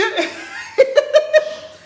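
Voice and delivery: high-pitched burst of laughter; high loud laugh. Real, not acted. Reacting to a viewer's rumour, loud high laugh. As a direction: crack up laughing loudly.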